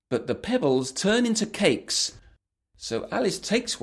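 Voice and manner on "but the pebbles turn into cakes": ordinary delivery, nothing marked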